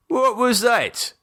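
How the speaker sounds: a hint of a stern father; dad questioning daughter; mock-stern dad.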